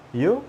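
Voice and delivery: "You?" swept upward, high; questioning intonation. Shown as a demonstration. Teacher showing the short reply question, "You?" rising high. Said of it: "the intonation is important".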